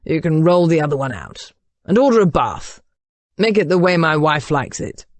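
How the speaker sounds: commanding, lightly grumbly voice. A narrator's reading. Duke ordering a bath for his wife, grumbly. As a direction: give orders like a rumbling beast. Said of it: "his grumbly voice sounding as if".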